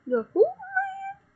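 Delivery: high, slow, squeaky "who man"; squeaky confused question. Acted. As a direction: play dumb in a squeaky voice. Mock confused villain repeating.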